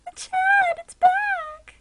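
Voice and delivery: very high, squeaky mock delight; mock excited squeal. Streamer mimicking her own joy at chat, squeaky. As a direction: squeal it like a cartoon fan. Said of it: "'cause I'm like".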